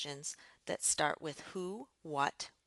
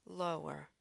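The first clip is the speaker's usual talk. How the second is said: "lower" dropped in pitch; 4 dB quieter than usual. Shown as a demonstration. Teacher showing learners pitch changes, lower pitch. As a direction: say "lower" down low. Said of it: "and sometimes lower".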